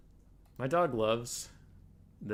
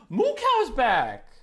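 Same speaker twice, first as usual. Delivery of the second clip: gasp, then a high, slow, loud cry of joy; thrilled greeting; gasping delight; 10 semitones higher, 11 dB louder, much slower than usual. Real, not acted. Streamer spots his pet coming back, excited gasp.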